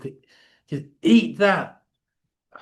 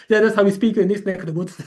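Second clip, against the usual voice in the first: fast, higher and louder through laughter; laughing; 6 semitones higher, 3 dB louder, much faster than usual. Real, not acted. Amused at the caller, laughs about his own accent. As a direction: laugh it off while explaining.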